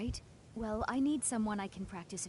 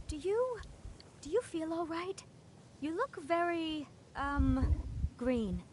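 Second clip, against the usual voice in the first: a worried mage realizing the spell misfired; startled and apologetic, pitch shooting up; alarmed concern; 7 semitones higher, slower than usual. Acted. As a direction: voice jumps much higher, flustered. Spell went wrong on him.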